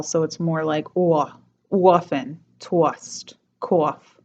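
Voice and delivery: orphan, tossed, cough with the O turned into a gliding diphthong; New York accent, round the O in tossed into a glide. Shown as a demonstration. Teacher demos the New York O diphthong. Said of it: "that's turning into a diphthong".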